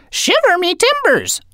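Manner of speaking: very high squawking parrot. Acted. Parrot echoing its owner, high squawk. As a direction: screech it back like a parrot. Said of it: "Salty echoed".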